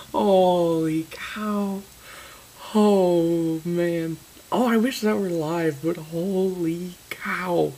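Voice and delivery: excited awe. Real, not acted. Still reacting to the pull.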